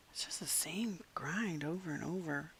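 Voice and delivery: breathy tired whisper. Real, not acted. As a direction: whisper it, worn out. Whispers many lines.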